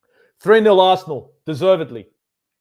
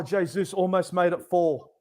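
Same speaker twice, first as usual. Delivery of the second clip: ordinary delivery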